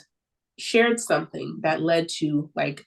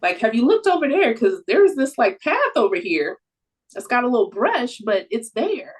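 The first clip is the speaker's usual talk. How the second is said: higher, louder eager nudge; animated coaxing; 5 semitones higher, 5 dB louder than usual. Acted. Coaxing a venting person toward the path over here, animated. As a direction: eagerly point out another way. Acting out encouragement to venting friend.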